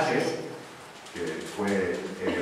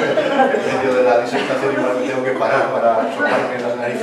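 laughing; 8 dB louder than usual. Real, not acted. Joking about blowing her nose.

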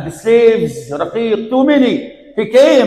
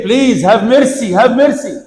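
raised, drawn-out plea, voiced by a preacher; retold begging; 5 semitones higher, 4 dB louder than usual. People beg the cruel wazir for mercy, retold begging. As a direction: beg with higher pitch, in storytelling earnest. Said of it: "they used to beg him".

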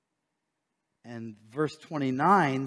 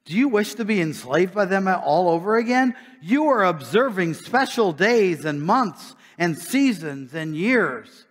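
louder, higher preacher voice; preaching scripture; 5 semitones higher, 6 dB louder than usual. Acted. Preacher reading Paul's rebuke to the church, raised and driving. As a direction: read it like a rebuke from the pulpit.

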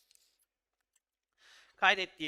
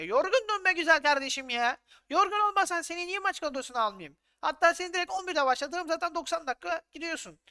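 loud, higher, fed-up scolding; exasperated retort; 8 semitones higher, 4 dB louder than usual. Acted. Manager loudly scolds the player who complained about resting. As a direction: tell him off like an annoyed boss.

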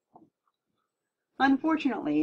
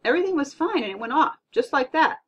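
mildly upset, raised higher voice; baffled complaint; 6 semitones higher, 6 dB louder than usual. A narrator's reading. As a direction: explain the breakdown, a bit put out. Parent reacts to dead TV.

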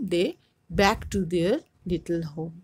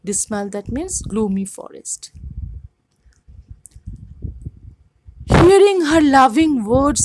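raised, lively read-aloud voice for the class; story reading; 3 semitones higher, 6 dB louder than usual. A narrator's reading. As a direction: read the folktale out to students with expression. Teacher reads story aloud.

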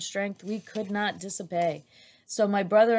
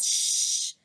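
sharp unvoiced shush. A narrator's reading. Boy behind her silencing Min Lee, hushing. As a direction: hush someone with a long "shh". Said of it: "the voice said".

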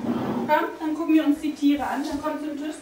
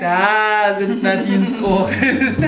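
usual speaking voice

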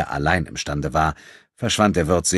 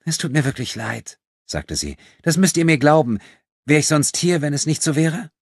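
put-on high, earnest girl's voice growing louder; pleading young thief; 10 semitones higher, 5 dB louder than usual. A narrator's reading. Thief apologising to the man she robbed, pleading.

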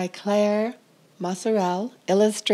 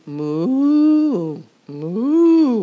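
mooing; 4 semitones higher, 5 dB louder than usual. A narrator's reading. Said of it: "went the cow".

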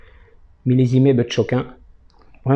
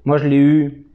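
talking around pipe stem; pipe in mouth. Real, not acted. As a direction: lips clenched on pipe. Seen 0: Pipe stem held between lips throughout, briefly removed near 6.6s.

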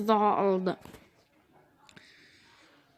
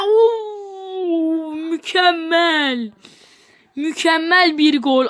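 high, loud, stretched-out cheer; thrilled goal cry; 4 semitones higher, 4 dB louder, much slower than usual. Real, not acted. Gamer cheering his own goal, drawn out and thrilled. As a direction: savor the goal with a long cry.

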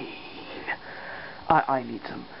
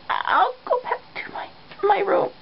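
tiny high-pitched captive voice; meek retreat; 13 semitones higher than usual. Acted. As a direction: back off in a high squeak. Captive retreats when caught.

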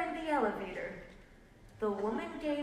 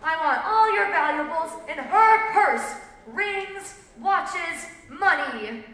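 louder, higher voice easing down the list; robber's demand; 4 semitones higher, 7 dB louder than usual. A narrator's reading. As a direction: demand it like a stick-up man. Robber demanding valuables in story.

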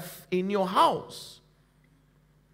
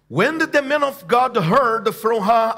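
normal talking voice

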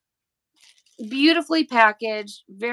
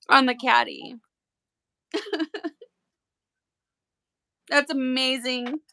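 normal talking voice

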